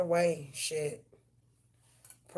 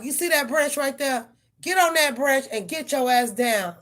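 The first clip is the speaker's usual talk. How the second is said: louder, higher step-by-step directions; loud instructions; 6 semitones higher, 8 dB louder than usual. Retold or imitated. Owner coaching cat down the branch, loud instructions.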